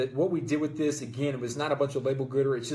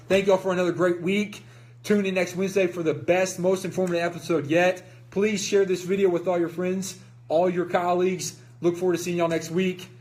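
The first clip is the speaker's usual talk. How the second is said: upbeat, raised sign-off to viewers; show host outro; 5 semitones higher, 4 dB louder than usual. Acted. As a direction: wrap up the show with energy, a bit higher.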